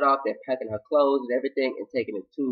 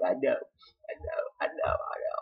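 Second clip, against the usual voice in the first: hushed acted surrender; 9 dB quieter than usual. Crying man giving in to the woman helping him, hushed surrender. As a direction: let it fade almost to a whisper.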